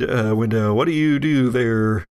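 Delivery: ordinary talk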